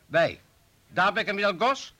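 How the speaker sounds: high, sharp questioning; pointed challenge. Acted. Colleague challenges the chief with pointed high questions. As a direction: press him with each question.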